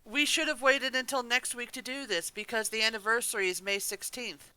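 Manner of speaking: ordinary delivery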